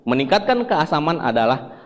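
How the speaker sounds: normal talking voice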